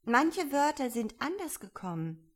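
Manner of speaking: lightly voiced girlish Alice; apologetic Alice. A narrator's reading. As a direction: offer an excuse as young Alice. Alice excuses her recital.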